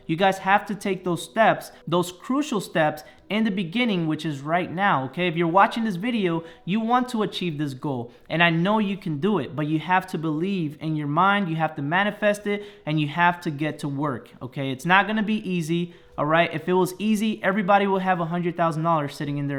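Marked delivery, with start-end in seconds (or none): none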